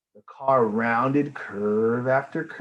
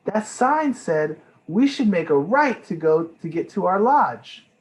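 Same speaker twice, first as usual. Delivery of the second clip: put-on excited child; 7 semitones higher, 6 dB louder than usual. Amelia points out sign.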